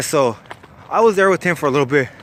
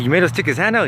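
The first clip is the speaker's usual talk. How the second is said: higher, disbelieving check-back; incredulous question; 5 semitones higher, faster than usual. Retold or imitated. Narrator questions cellmate's tall tale, incredulous. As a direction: retell it as an astonished question. Retelling his shocked question.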